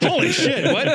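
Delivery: high, laughing disbelief; shocked exclamation. Retold or imitated. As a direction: blurt it out in shock. Imagined shocked reaction.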